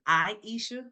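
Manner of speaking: "Aisha" in three syllables, eye-EE-sha; correct name pronunciation. Shown as a demonstration. Guest corrects how the host says her name, "Aisha" as eye-EE-sha. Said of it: "mispronounce that".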